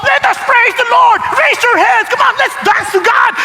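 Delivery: a hint of a pushy worship leader urging the crowd; mock worship leader. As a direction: imitate a pushy praise leader egging people on.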